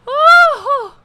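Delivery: high, loud woo-hoo; loud mocking cheer; whooping shout. Real, not acted. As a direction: whoop like you were just pranked. Reacts to the prank reveal.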